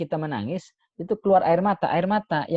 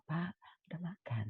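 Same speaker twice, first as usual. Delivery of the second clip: gentle, careful asking; 3 semitones higher, 13 dB quieter than usual. Shown as a demonstration. Child asking a hard-of-hearing father if he has eaten, gently. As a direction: ask dad softly, no snapping. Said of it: "Agak kencengin dikit. Tapi jangan sampai bentak".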